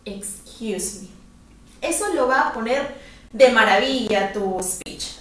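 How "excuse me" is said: soft, slow and polite: Excuse me. Shown as a demonstration. Teacher models asking a stranger, softly polite. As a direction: ask a stranger gently and slowly. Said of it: "un poquito de educación a esa frase".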